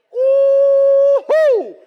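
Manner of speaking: very high, loud laughing whoop; delighted whoop. Real, not acted. Preacher whooping with laughter at his old tracksuit. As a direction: whoop with glee.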